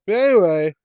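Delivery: loud, high show intro; excited host call. Real, not acted. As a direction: pump up the segment opener. Host kicks off the episode segment.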